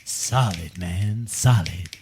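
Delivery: low, cool beatnik drawl; beatnik hipster. Pretend. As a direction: play the laid-back beatnik. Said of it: "I did my best hipster duty".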